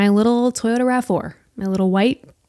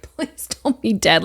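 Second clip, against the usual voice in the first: soft unvoiced plea; scared whisper over the fallen man; frightened whisper; 4 dB quieter than usual. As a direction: whisper it as if praying he's alive. Praying the stranger is alive.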